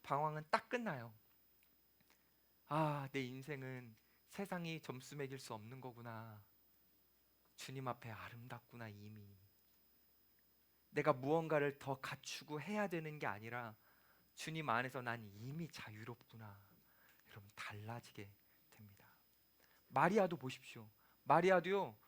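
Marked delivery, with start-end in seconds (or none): 2.7-16.6 gentle awe; soft inner realization. Pretend. Realization voiced softly. 17.3-19.1 soft unvoiced assurance; hushed promise; whisper. Real, not acted. Whispered close of point.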